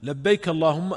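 normal talking voice

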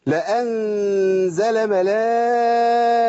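ملائكة read slowly and stopped on a هاء sound; slow recitation. Shown as a demonstration. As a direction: draw out لأنزل ملائكة, ending on ملائكه. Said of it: "أقف بهاء". (الوقف على ملائكة بالهاء)

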